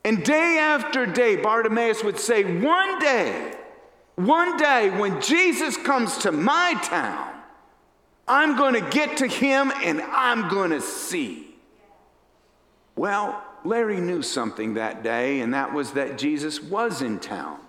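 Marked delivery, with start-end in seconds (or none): 2.6-11.5 high, loud, emphatic vow; hopeful declaration. Acted. Blind Bartimaeus vowing he will reach Jesus, hopeful declaration. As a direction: declare it with growing conviction, pitched up. Voicing a blind man's longing resolve.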